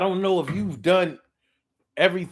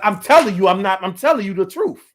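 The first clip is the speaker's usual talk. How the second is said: insistent; 3 semitones higher, 7 dB louder than usual. Retold or imitated. Liar bullies you into believing.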